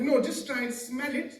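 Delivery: high, loud insistent coaxing; pushy Pakistani attar salesman; adamant seller. Acted. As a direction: press the buyer to try it. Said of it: "So he was being adamant".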